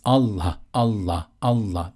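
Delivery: teacher showing the wrong way, saying the name out loud; spoken aloud, wrong way. Shown as a demonstration. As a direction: voice each Allah fully aloud as the thing to avoid. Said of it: "it's not like this".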